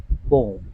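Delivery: "पोम" in one syllable, POHM; no "yum" in "पोम", just one long o; claimed correct pronunciation. Shown as a demonstration. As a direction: say "पोम" as pohm, rhyming with home. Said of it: "सही उच्चारण पोम ही है".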